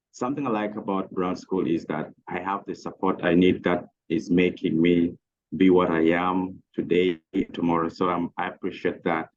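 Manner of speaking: usual speaking voice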